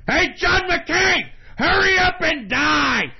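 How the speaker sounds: high, loud, drawn-out holler; full-throated ranting shout; angry yell. Real, not acted. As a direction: yell it out at someone far away. Calling out angrily to absent politician.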